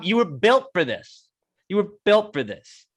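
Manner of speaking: high, drawn-out mock-epic admiration; hyped-up retold compliment; emphatic praise. Retold praise of delivery driver.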